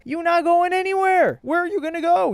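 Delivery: high, strained mock voice of the boyfriend. Commentator mimics the boyfriend taunting his girlfriend, high mock voice. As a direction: squeak it out like a smug boyfriend taunting her. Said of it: "he's looking at her like".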